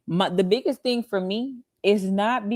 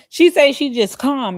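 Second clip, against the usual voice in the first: incredulous; 6 semitones higher, 7 dB louder than usual. Real, not acted. Repeats it, louder, incredulous.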